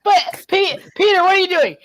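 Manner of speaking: high, lightly voiced disbelief; a light take on a startled teen; mock Miles. Joining the skit, voicing Miles in high disbelief.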